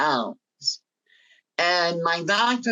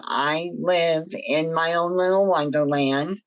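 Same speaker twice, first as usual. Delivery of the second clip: ordinary talk, nothing marked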